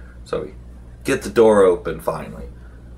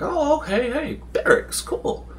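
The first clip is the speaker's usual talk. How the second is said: much higher, squeaky fake delight over the barracks; mock excited. Party exploring a dungeon finds beds, mock excited. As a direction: play it up like finding treasure.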